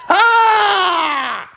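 short high shout of triumph. Acted. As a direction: bark out a triumphant ha. Shout heard.

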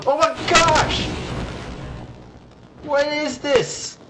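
high startled shout, then slow disbelief; shocked yelp. Real, not acted. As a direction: gasp it out in alarm. Player hit by game hazard.

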